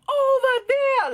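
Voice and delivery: very high, drawn-out mocking cry; imitated angry shopkeeper. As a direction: stretch it out high, mimicking her right in someone's face. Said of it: "she comes in my face, and she's like".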